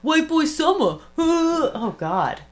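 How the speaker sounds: high, hammed-up whiny holler; mock a little man yelling from below; mocking streamer impression. Imitating a streamer begging into a pool party, mock holler. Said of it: "Can you imagine that little midget up there".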